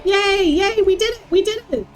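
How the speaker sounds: usual speaking voice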